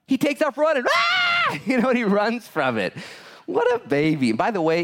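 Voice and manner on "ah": high startled shriek of a man fleeing; mock scream. Moses screaming as he runs from the snake, a hammed-up shriek. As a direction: yelp in panic as the snake appears.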